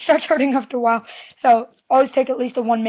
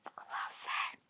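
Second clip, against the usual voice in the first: breathy close whisper; 20 dB quieter than usual. Shown as a demonstration. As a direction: lean in and whisper it. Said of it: "So come up closer".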